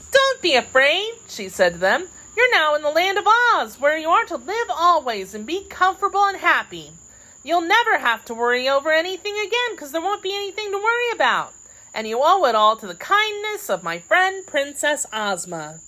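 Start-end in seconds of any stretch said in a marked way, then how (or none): 0.1-1.1 put-on high-pitched little girl voice; young Dorothy, reassuring. A narrator's reading. Dorothy calms her aunt and uncle, high and girlish. 2.3-15.8 put-on high-pitched little girl voice; young Dorothy, reassuring. A narrator's reading. Dorothy calms her aunt and uncle, high and girlish.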